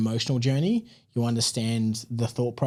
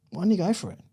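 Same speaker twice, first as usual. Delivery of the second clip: higher, encouraging wife's voice, rising at the end; imitating wife; 7 semitones higher than usual. Wife urging husband to take the job, higher encouraging voice. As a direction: coax him like his wife did, pitched up. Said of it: "my missus was just like".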